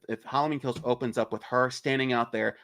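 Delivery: normal talking voice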